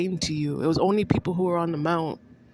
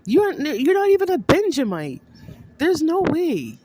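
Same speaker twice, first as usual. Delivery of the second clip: heated outburst; 9 semitones higher, 4 dB louder than usual. Real, not acted. Heated rant at Justin.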